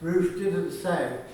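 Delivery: usual speaking voice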